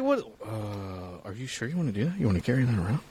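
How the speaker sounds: wary, faltering bank clerk; tentative and stalling, softly doubtful; hesitant bank teller. Acted. Bank teller questioning a cash withdrawal, hesitant.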